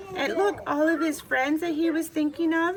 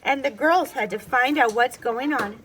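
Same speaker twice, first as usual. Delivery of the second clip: ordinary delivery, nothing marked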